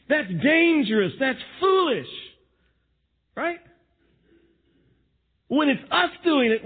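ordinary talk

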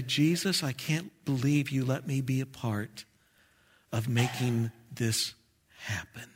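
soft, hushed words to Jesus, fading away; humbled gratitude. Acted. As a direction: say it quietly, as a thankful prayer. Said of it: "to be able to say".

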